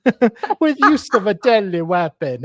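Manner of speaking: put-on accidental Italian-sounding accent. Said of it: "now you're Italian?".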